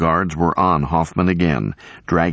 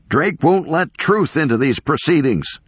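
high-pitched protest at moderate volume; agitated professor calling out; acted yell; 11 semitones higher than usual. A narrator's reading. Professor denouncing the proceedings to the attorney, narrated yell. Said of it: "Hoffman yelled to Evans".

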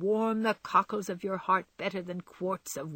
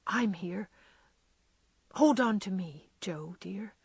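choked whisper. Acted. Laurie comforts crying Jo, choked whisper. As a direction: whisper comfort through held-back tears. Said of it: "whispering as well as he could".

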